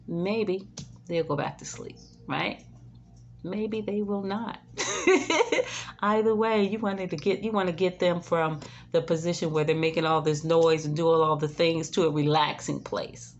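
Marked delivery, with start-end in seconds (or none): none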